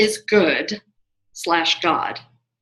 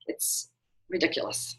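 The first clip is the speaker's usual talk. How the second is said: whispered aside; 8 semitones lower, 10 dB quieter than usual. Real, not acted. Complaining about certification.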